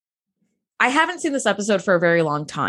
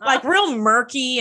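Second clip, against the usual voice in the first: laughing while talking; 4 semitones higher, slower than usual. Real, not acted. Laughing through her own joke.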